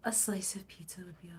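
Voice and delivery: fast hushed whisper; quick whispered musing. Real, not acted. Whispered aside while thinking.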